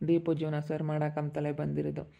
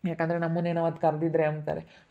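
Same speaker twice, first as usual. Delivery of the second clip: ordinary talk, nothing marked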